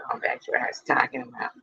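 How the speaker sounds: fast, whispered give-up; whispered aside. Real, not acted. Streamer giving up on chat, whispered. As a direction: mutter it under your breath.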